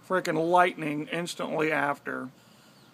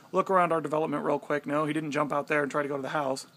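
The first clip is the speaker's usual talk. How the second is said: ordinary talk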